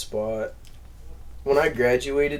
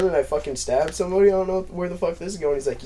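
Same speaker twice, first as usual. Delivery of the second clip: usual speaking voice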